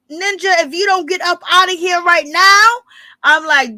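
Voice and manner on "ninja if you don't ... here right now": high-pitched, louder mock warning; rising, exaggerated ultimatum; mock threat. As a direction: imitate a fed-up woman ordering him out. Voicing her threatening look at him.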